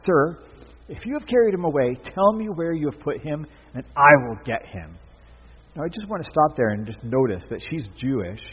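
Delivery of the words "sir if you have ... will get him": higher and louder, urgent plea; Mary begging the gardener for the body, loud and urgent; pleading Mary. A narrator's reading. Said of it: "she replied".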